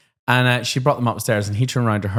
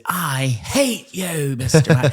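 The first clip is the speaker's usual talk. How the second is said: mock child's hate; 6 semitones higher, much slower than usual. Child hating teacher, retold.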